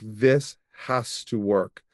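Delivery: slow, set-jawed resolve; determined. Retold or imitated. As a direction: say it like a vow, slowly. Grim resolve after big spend.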